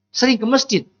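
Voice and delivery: high, searching question; God questioning. Acted. God asking the angel about the man, high and questioning. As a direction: ask the angel sharply, pitched high. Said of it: "Kata Allah kepada malaikat".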